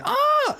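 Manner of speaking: high-pitched mock scream; short, high "ah" imitating a woman in the film; imitated scream. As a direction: let out a mock squeal. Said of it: "going like".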